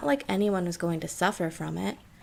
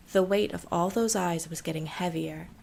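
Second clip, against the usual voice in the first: plain reading voice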